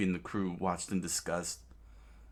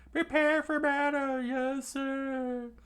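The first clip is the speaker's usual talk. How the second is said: high squeaky soldier; eager rebel; 3 dB louder, slower than usual. Acted. Rebel soldier acknowledging orders, high voiced. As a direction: snap to it like a keen recruit.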